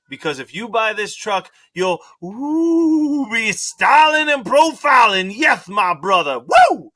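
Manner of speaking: high, loud, slow wrestling hype; wrestler impression. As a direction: ham it up like a wrestler. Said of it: "he just goes".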